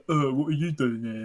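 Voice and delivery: put-on voice, imitating a reluctant welcome; mock unwelcoming greeting. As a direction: mimic a put-out, grudging greeting. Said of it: "when I come in, you go".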